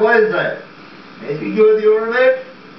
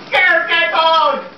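panicked yell; 10 semitones higher, much slower than usual. Acted. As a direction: drag the words out in a frightened shout. Startled man orders intruder out.

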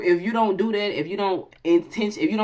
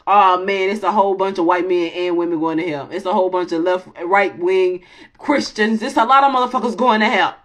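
loud comic rant; 7 dB louder than usual. Real, not acted. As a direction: rant it for laughs.

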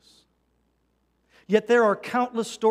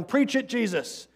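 lightly acted cheering amen, higher pitched; mock crowd shout; 7 semitones higher than usual. Self-satisfied listeners cheering Jesus on, mock shout. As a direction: call it out like an approving congregation, half-shouted. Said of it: "they're shouting".

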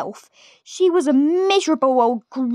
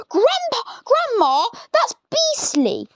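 put-on much higher whiny boy's protest; horrified little George; 12 semitones higher than usual. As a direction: squeal it like a disgusted child. George disgusted.

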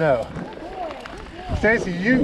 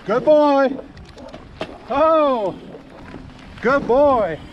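calling from far away; 10 semitones higher, slower than usual. Real, not acted. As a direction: call out to the running dog. Calling dog running far ahead.

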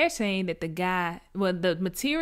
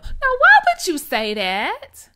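much higher and louder, incredulous; outraged exclamation; 14 semitones higher, 10 dB louder than usual. Real, not acted. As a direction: shout the question in disbelief. Outraged at grandfather's remark.

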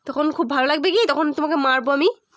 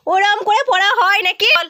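loud, high exasperated scold; mother scolding her son about studying; 7 semitones higher, 6 dB louder than usual. Real, not acted.

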